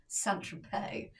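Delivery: whispered, drawn-out place name; hushed retold hesitation. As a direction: say it slowly under your breath, imitating her. Imitates Katie's hesitant question.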